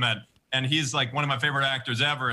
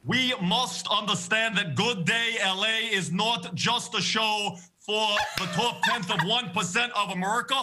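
Bernie Sanders impression; 7 semitones higher than usual. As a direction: rail at the top one percent like an old senator. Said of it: "Bernie Sanders".

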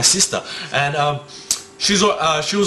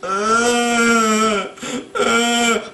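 long, higher-pitched eerie moan; 6 semitones higher than usual. Shown as a demonstration.

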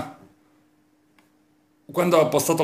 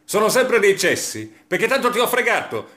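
normal talking voice

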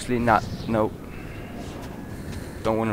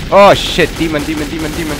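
high, loud, repeated warning; alarmed shouting; 7 semitones higher, 11 dB louder than usual. Real, not acted. Gamer spotting another demon, alarmed shout. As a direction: blurt the alarm as danger appears.

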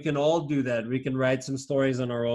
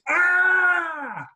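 long, high scream; high wail, sharply falling at the end; mock scream; 4 dB louder than usual. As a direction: act out a long story scream. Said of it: "And then he screamed".

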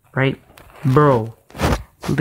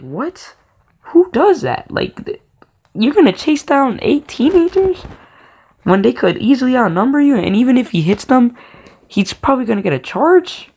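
much higher, imitating his own baffled thoughts; incredulous inner voice; 10 semitones higher than usual. Boy's inner voice questioning his dad's plan, high and incredulous. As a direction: rant in disbelief at the plan. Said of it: "I just thought in my mind".

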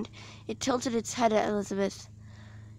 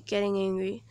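ordinary delivery, nothing marked

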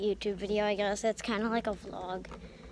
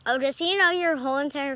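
calling out; 5 semitones higher, 5 dB louder, faster than usual. Real, not acted. Calling out to someone.

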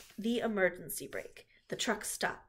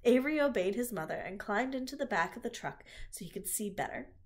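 ordinary delivery, nothing marked

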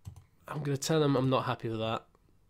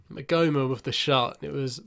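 ordinary delivery

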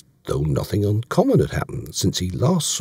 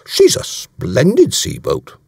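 slow, louder, higher protest; affronted, pitched up and emphatic; indignant; 7 semitones higher, 5 dB louder, slower than usual. A narrator's reading. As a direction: defend it indignantly, drawing words out. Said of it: "said Davies indignantly".

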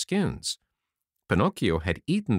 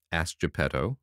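ordinary delivery, nothing marked

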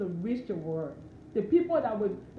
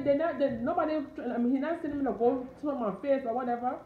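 normal talking voice

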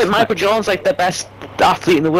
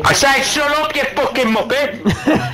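higher, insistent shout; angry yell; 8 semitones higher than usual. Real, not acted. Yelling again at Alexa to stop. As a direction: bark it at the device.

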